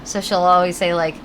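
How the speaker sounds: ordinary delivery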